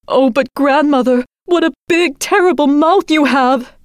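high, rising alarm; frightened girl. Acted. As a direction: voice climbing in fear.

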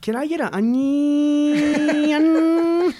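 put-on very slow, high, stretched-vowel Valley Girl; mock a Valley Girl, stretching ONION out endlessly. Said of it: "those syllables or vowels get stretched".

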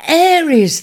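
high, loud shout of the name; joyful cry. A narrator's reading. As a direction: call out in delight. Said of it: "they cried".

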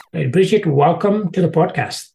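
ordinary delivery

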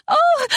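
short high squeal. Acted. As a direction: squeal in sudden surprise. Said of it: "and she squealed".

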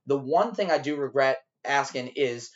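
ordinary talk, nothing marked

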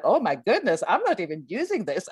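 mock amazed realization, pitch swooping; excited. Imagined person discovering spare brain, excited. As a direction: act out a giddy discovery, rushing at the end.

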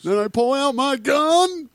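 high, slow put-on voice; cartoon parody threat. Cornered cartoon star in the closet, high slow mock threat.

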